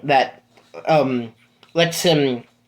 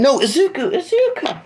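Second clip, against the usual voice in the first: high, cracking cry of alarm; desperate cry; 13 semitones higher than usual. Acted. Sue crying out as Deku passes out. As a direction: scream his name as he collapses. Same cry, name corrected.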